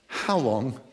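low, hushed and drawn out; quiet aching plea. Shown as a demonstration. Pastor showing the congregation the psalm's ache, hushed. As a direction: let the question ache softly. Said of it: "You hear it?".

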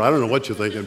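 usual speaking voice

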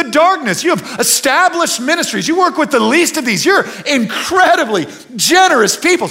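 rally the room with full conviction; fervent preaching. Acted. Preaches to congregation, rising fervor.

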